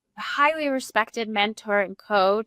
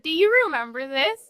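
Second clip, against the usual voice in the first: ordinary talk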